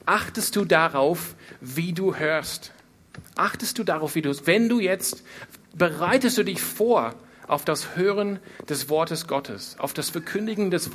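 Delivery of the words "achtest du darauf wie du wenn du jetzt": ordinary talk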